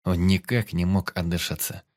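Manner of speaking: plain reading voice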